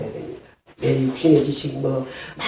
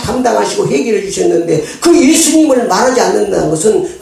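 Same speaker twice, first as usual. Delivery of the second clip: full-force preaching; 7 semitones higher, 7 dB louder than usual. Real, not acted.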